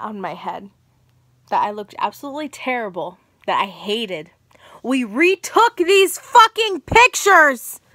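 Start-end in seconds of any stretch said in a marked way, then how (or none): 4.8-7.8 loud, slow, furious yell; angry shout. Real, not acted. Vlogger ranting to viewers, loud and slow with fury. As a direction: spit it out slowly, livid. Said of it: "I'm mad".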